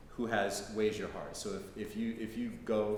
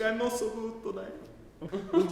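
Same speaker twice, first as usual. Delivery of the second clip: put-on higher and slow, a mournful old widow; sad old Italian lady; 11 semitones higher, slower than usual. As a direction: drag it out high like a grieving grandmother. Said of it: "She'd be like".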